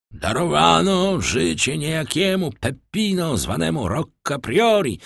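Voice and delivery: high, ringing proclamation; formal announcement. A narrator's reading. Brotherhood superior reading out the pardon, proclaiming. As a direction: announce the reprieve to the crowd. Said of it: "oznajmił przełożony bractwa pokutników".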